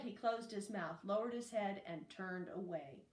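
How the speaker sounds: ordinary delivery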